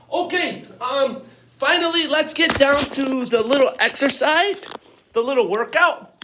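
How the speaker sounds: loud, upbeat kickoff; excited announcement. Real, not acted. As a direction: pump them up for the workout. Announcing the workout with energy.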